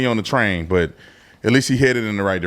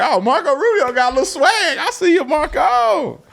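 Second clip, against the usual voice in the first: high, slow, playful falsetto tease; much higher mock-impressed shout-out; impressed and teasing. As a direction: hype him up like a friend showing off. Reacting to Rubio's dap.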